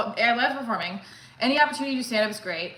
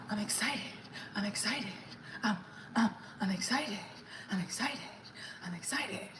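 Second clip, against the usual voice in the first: softer breathy whisper of glee; excited whisper; 2 semitones lower, 13 dB quieter than usual. Real, not acted. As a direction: whisper it to yourself, giddy. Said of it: "Sorry, I'm excited".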